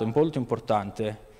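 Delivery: ordinary talk, nothing marked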